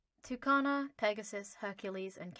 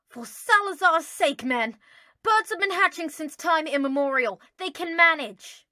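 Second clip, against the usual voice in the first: exasperated; 8 semitones higher, 16 dB louder than usual. Acted. Draco dragged along, loudly exasperated. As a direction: scold him like he's being ridiculous.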